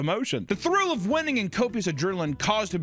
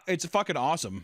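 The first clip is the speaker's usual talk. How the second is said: ordinary talk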